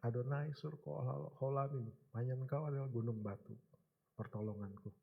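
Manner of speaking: soft, low, fervent crying out to God; quiet, low prayer recalled from the hospital; hushed prayer. Retold or imitated. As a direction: pray it quietly as if in tears. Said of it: "Kita menangis, kita berseru".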